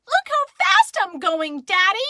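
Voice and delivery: excited scream. Acted. Child screams to Daddy while running, excited scream. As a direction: shout it proudly at full excitement. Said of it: "I screamed".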